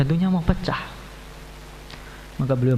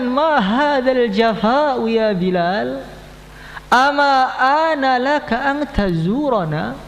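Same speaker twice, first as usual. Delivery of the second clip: the Prophet in a dream chiding Bilal; longing reproach; 8 semitones higher, much slower than usual. Acted. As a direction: draw out each Arabic word with yearning.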